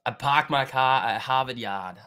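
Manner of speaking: slow, hammed-up Boston accent; mock Boston accent. Streamer jokes to chat in a mock Boston accent. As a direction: play a local Boston accent for laughs. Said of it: "that's not how I talk".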